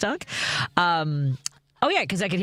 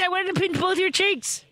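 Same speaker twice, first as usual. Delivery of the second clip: gushing; 11 semitones higher than usual. Real, not acted. Gushing over a cute guy.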